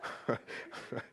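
breathy unvoiced chuckle; soft airy laugh; real laugh. Real, not acted. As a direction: laugh quietly at yourself. Laughing at own remark.

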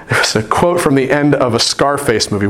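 ordinary delivery

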